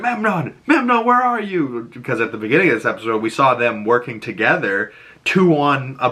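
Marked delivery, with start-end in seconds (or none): 0.0-1.9 high, anxious call across a battlefield. Retold or imitated. Achilles searching for Memnon mid-battle, calling out high. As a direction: shout the name up high, searching.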